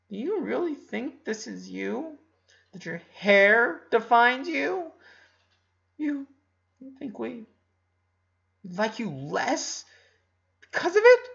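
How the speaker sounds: high, tearful plea into his chest; tearful, pleading. Acted. Ronnie Ann, crying into Lincoln's chest, pleading tearfully. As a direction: voice cracking with hurt, rising at the end. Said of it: "Ronnie Ann crying into chest".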